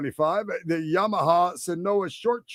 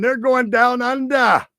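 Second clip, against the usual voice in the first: high, loud, hammed-up Aussie accent; terrible Australian accent; 7 semitones higher, 5 dB louder than usual. Announcer hams up the Formula One trip down under in a bad Australian accent. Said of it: "That's a terrible accent".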